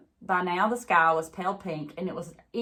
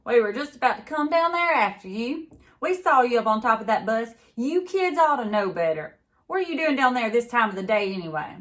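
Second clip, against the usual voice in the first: put-on louder, higher scolding of the kids; scolding deputy; 5 semitones higher, 6 dB louder than usual. A narrator's reading. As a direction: tell off a kid like a stern deputy. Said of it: "the deputy said".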